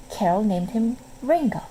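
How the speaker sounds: usual speaking voice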